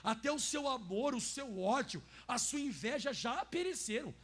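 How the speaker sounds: slow, loud and weighty; strong emphasis. Shown as a demonstration. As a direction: lean hard on every word. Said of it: "E agora é forte". (ênfase forte, lenta e alta)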